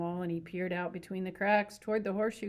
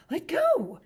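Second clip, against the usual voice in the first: much higher, louder angry outburst; angry cry; 12 semitones higher, 10 dB louder than usual. Acted. Curly's wife to Lenny, furious high cry. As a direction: snap at him furiously. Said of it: "she cried".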